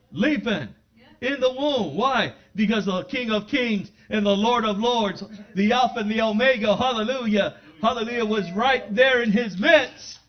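loud, building preacher cry; preaching at full force. Acted. Preacher rousing the saints with loud, building praise. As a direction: lift the hall with rising praise.

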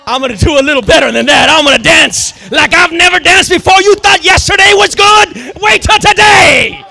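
David roaring back at his wife, full voice; defiant shout. Retold or imitated. David answers his mocking wife.

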